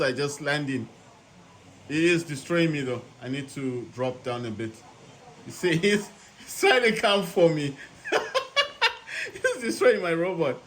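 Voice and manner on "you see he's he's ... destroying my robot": high, giggling through the words; helpless laughter under attack; laughing. Real, not acted. Laughing while being attacked.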